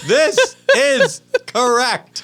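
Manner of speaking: high, slow, laughing mock worship; laughing in awe. Real, not acted. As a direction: laugh through a grand proclamation.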